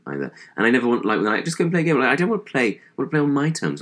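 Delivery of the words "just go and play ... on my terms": put-on childhood refusal. Quotes childhood self refusing games.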